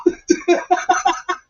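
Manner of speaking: high laugh. Real, not acted. Laughing at own story.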